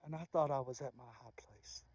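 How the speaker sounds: a hushed, breathy whisper. Real, not acted. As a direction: let it fall to a bare whisper. Whispered confession.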